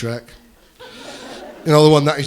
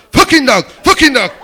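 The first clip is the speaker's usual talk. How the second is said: repeated high, loud mock yells; screaming outburst; 11 semitones higher, 9 dB louder than usual. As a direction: shout it like a ranting old man. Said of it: "who starts screaming and starts shouting".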